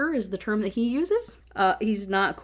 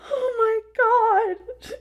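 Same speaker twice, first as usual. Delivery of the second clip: anguished wail; 6 dB louder, much slower than usual. Real, not acted. Exasperated at the scene.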